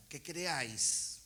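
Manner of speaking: que creáis slowly, each syllable weighted; stress on creáis. Shown as a demonstration. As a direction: lean hard on creáis, drawn out. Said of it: "Remarcá esta palabra". (recalcá "creáis", lento y marcado)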